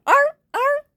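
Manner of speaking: very high, cartoon seal arfs; squeaky seal barking. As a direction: yip it like a tiny seal pup. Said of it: "instead of".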